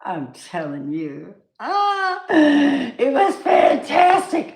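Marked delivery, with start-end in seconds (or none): 1.6-4.5 high, loud, slow rapture; ecstatic, almost swooning; gushing excitement. Real, not acted. Raving about church visit.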